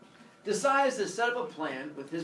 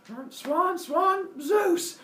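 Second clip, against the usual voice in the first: high, louder swan squawks; 12 semitones higher, 4 dB louder, much slower than usual. Acted. Swan turning back into Zeus, squawking reveal. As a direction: squawk each word like a cartoon bird. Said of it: "Zeus the swan goes".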